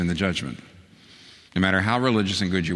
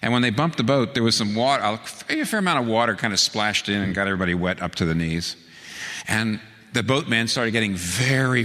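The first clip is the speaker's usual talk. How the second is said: ordinary talk, nothing marked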